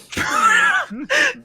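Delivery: high shrieking laugh; real cackle at own attempt; burst of laughter. Real, not acted. Game master laughs at their own name pick, loud high laugh.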